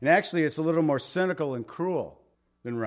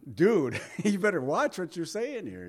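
higher-pitched playful threat with a laugh; mock tough warning; 5 semitones higher than usual. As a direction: warn him off, half laughing. Imagined Job squaring up to fight.

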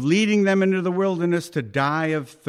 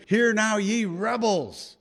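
Moses rebuking; 7 semitones higher, slower than usual. Retold or imitated. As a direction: hurl it at the rebels, sharply. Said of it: "he said".

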